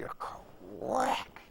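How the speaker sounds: high breathy quack of rotor blades; imitated blade quack. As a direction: mimic the rotor sound high and airy. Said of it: "that noise".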